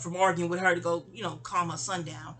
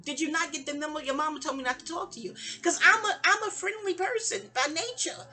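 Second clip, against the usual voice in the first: higher-pitched, incredulous retort; retold shock; 7 semitones higher than usual. Telling how she faced her yelling cousin, mock shocked. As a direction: replay your disbelief at being yelled at. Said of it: "in shock, like".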